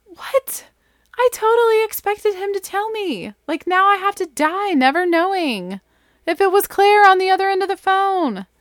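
outraged at being denied an answer; shocked disbelief. Retold or imitated. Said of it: "And I was like".

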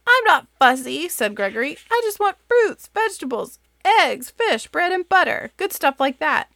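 high, loud insistent little goat; Gregory the goat. Acted. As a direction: list it eagerly, defending himself.

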